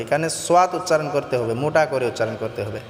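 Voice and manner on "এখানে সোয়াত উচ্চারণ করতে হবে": usual speaking voice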